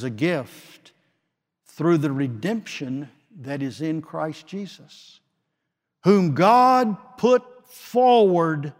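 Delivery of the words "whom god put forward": much louder, slow and drawn out; booming proclamation. Real, not acted. Preacher to his congregation, slow booming proclamation. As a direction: proclaim it to the back row.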